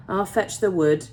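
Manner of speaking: usual speaking voice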